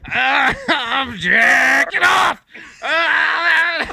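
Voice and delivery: loud hammed-up moaning; slow loud hammy groaning; mock moaning. Joke moaning bit.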